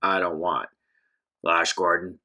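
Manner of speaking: a menacing stranger over your shoulder, growled low; deep gravelly thug. Acted. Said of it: "a deep, gravelly voice".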